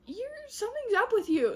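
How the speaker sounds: put-on high-pitched suspicious girlfriend; mock concern. She-cat confronting her boyfriend, high mock concern. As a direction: pitch it up, imitating a worried challenge. Acted quarrel between lovers.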